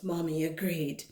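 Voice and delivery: usual speaking voice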